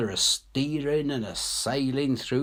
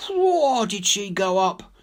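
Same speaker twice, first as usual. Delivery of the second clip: excited whoa; 9 semitones higher, 4 dB louder than usual. Acted. Ship blown up.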